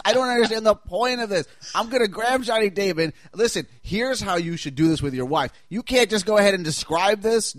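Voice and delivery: lightly voiced pushy instructor; Geraldo impression. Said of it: "Geraldo's demonstrations of how some of the pictures should go".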